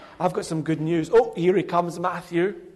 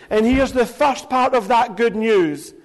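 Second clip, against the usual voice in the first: normal talking voice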